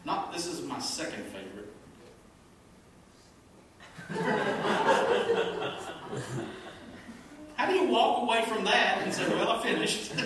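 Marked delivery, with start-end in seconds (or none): 3.6-7.5 loud laughing burst. Real, not acted. Pastor to congregation, loud laugh. 7.6-10.1 higher and louder, incredulous; mock the worker in disbelief. Real, not acted. Pastor to congregation, loud incredulous mockery. Mocking the meme maker.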